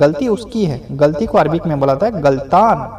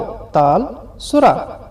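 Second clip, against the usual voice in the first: "ताल सुरा" drawn out, each word slow; slow Arabic phrase; 3 semitones higher, much slower than usual. Shown as a demonstration. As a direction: spell out "ताल सुरा" slowly for students. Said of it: "इसे Arabic में बुलाते हैं". ("ताल सुरा" धीरे धीरे बोलिए)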